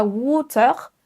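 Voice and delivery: water ending in a French r; French-accented water; wrong French R. Shown as a demonstration. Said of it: "On ne prononce pas".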